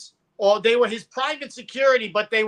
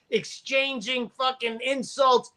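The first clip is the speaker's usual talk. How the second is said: usual speaking voice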